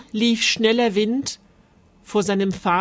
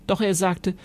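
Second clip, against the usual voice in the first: ordinary delivery, nothing marked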